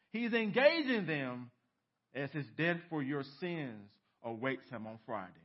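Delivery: ordinary talk, nothing marked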